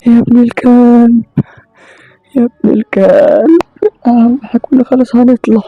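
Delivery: high, loud, slow tearful cursing; crying curse. Acted. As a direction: curse it out on the edge of tears. Cursing after escaping the chaser. (شتيمة عالية بصوت باكي)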